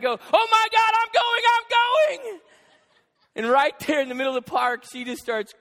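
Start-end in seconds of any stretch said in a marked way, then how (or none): 0.0-2.4 high, drawn-out comic shriek; mock panicked yell, far higher and louder; panicked kid. Daughter desperate for the bathroom shouting to dad, panicked.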